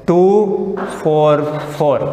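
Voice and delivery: locants read out one at a time, slowly; slow dictation. Shown as a demonstration. Teacher reading out the methyl addresses slowly. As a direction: tick off each number slowly. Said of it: "उसका address क्या है, देखो".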